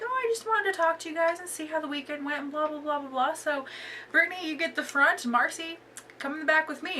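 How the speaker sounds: high, sugary mock-friendly boss voice; fake sweet. Manager greeting her staff with fake cheer, high sugary voice. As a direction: lay on the fake cheer before the ambush.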